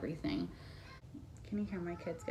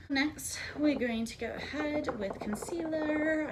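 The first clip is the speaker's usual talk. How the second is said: slipping into a higher-pitched British accent; 9 semitones higher, slower than usual. Real, not acted. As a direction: drift into a British lilt, pitched up. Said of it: "like, a British accent".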